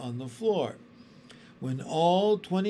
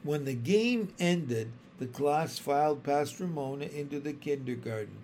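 ordinary delivery

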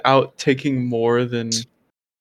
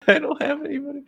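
high, fast mimicking whine; laugh, then parrot him in a high voice; mocking echo; 13 semitones higher, faster than usual. Mockingly echoes friend's complaint.

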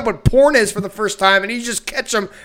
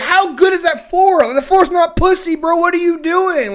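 higher-pitched incredulous mock rant; exasperated disbelief; 9 semitones higher, 3 dB louder than usual. Retelling a rant at the floor-humping cat, exasperated. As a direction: berate the cat in baffled outrage.

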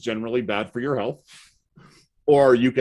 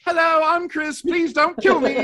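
put-on very high, timid English boy voice; new English boy greets the class in a high, frightened squeak; timid Pip voice; 4 dB louder than usual. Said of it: "like Pip from South Park".

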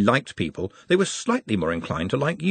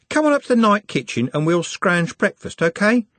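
higher-pitched casual lad, inviting; Trev, breezy lad; 9 semitones higher than usual. A narrator's reading. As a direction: talk a mate into breakfast, casual and higher.

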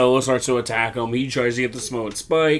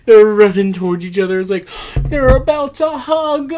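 mock gushing; 12 semitones higher, 8 dB louder than usual. Host narrating brothers about to hug, mock gushing. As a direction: voice climbing very high, loud at first.

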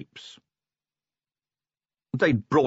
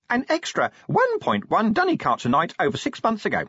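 imp voice; 12 semitones higher than usual. Acted. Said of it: "it announced".

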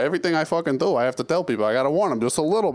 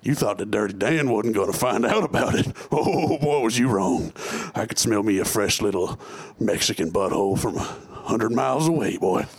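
sleazy villain voice; 4 semitones lower than usual. Pretend. Buddies hamming up low creepy villain characters. Villain characters Dirty Dan, Big Dale Daddy.

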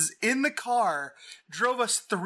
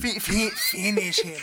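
Peter Lorre. Retold or imitated. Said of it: "sounds like Peter Lorre".

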